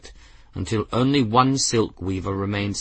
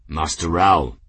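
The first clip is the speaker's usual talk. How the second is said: grave; 4 semitones lower, 4 dB louder than usual. A narrator's reading. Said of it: "Grimm greeted the cat gravely".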